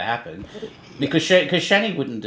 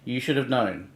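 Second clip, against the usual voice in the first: usual speaking voice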